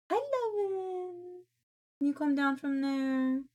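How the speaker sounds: high, slow cooing at the cat. Real, not acted. Streamer cooing at her cat to come down, high baby voice.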